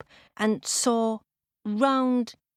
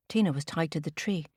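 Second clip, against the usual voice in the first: ordinary delivery, nothing marked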